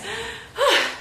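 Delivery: high winded sigh; out-of-breath sigh. Real, not acted. Host catching breath after running up the stairs, winded sigh. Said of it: "I'm a bit out of breath".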